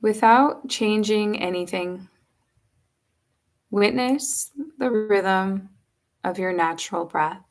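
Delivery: slow, unhurried yoga nidra guiding voice with long pauses; measured, spacious meditation delivery; guided meditation. Acted. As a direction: guide them gently into rest, leaving space between phrases.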